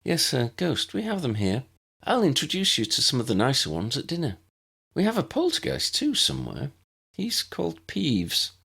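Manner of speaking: gently honest prefect; a hint of Tessa. A narrator's reading. Tessa explains ghosts.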